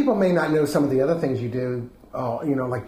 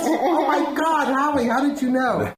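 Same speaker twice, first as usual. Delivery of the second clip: mock amazement; 10 semitones higher than usual. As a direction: gush over the trick, laughing. Mock amazement at the card trick.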